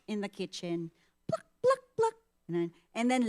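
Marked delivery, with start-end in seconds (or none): 1.3-2.1 high squeaky splash sounds; imitated splashing noise. Mom mimics the kitchen splashing, high and squeaky.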